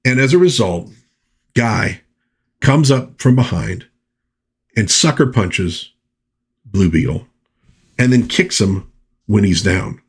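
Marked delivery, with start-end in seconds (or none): none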